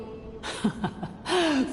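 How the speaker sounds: quiet scornful laugh; dismissive little laugh; soft derisive chuckle. Acted. Villain scoffs at good and evil.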